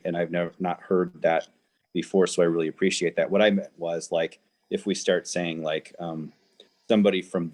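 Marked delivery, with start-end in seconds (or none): none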